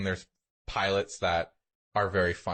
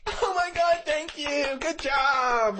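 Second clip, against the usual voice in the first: a much higher, gushing passenger voice; mock clapping passenger; 3 dB louder, much slower than usual. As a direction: gush like a clapping passenger, overdone. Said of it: "I shouldn't land and be like".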